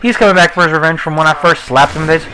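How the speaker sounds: usual speaking voice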